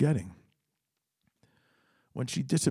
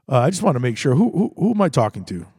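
pushy caller; 8 dB louder, faster than usual. Retold or imitated. Drunk father demands.

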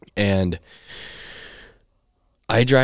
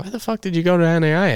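higher and fast, mock disbelief; incredulous teammate; 11 semitones higher, faster than usual. Teammates baffled by his school, high incredulous.

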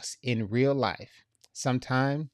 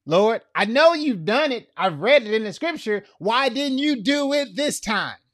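much higher and louder, crying out at God; pleading protest; 10 semitones higher, 6 dB louder than usual. Retold or imitated. Believer questioning God in grief, a pleading loud outcry. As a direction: demand an answer, pleading and hurt. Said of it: "I've been there too".

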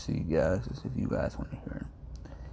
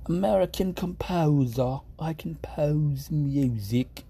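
slow, higher, lightly posh English accent; 4 semitones higher, slower than usual. Shown as a demonstration. Streamer trying a light English accent for composers. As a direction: drawl it like a refined English composer. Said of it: "that's the voice you have to have when you say that".

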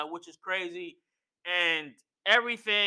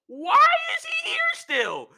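mock shrieked complaint. Imitating Heyman objecting to Sami, escalating high shriek. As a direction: escalate into a squealed protest.

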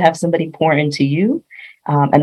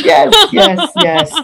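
high laughing yeses; 11 semitones higher, 3 dB louder, much slower than usual. Real, not acted. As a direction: laugh out the agreement. Guest laughing at host's joke.

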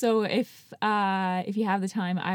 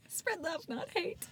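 softer and higher; soft high echo; 10 semitones higher, 12 dB quieter, slower than usual. Acted. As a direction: breathe the slogan softly, high. Echoed softly.

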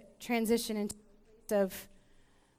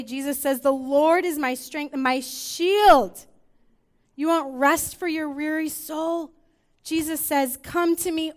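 preaching that builds louder and higher; rising preaching; 5 semitones higher, 7 dB louder than usual. Acted. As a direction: swell into a full-force sermon. Sermon building to full force.

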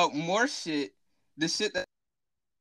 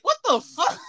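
incredulous; 11 semitones higher, 4 dB louder than usual. Retold or imitated. His stunned reply to Mario.

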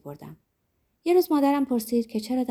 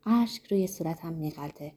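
plain reading voice

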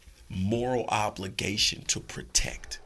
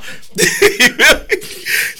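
shrieking high laugh; loud cackle; 16 dB louder than usual. Acted. Dog therapist bursts into a loud laugh.

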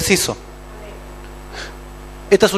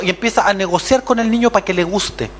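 normal talking voice

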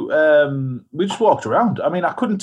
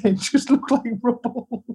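laughing; 5 semitones higher, slower than usual. Real, not acted. Laughing at the memory.

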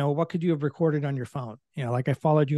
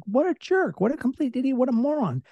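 high, scornful mock insults; mocking inner voice; 9 semitones higher than usual. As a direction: sneer it like a nasty thought in your head. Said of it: "this stuff that goes through our heads".

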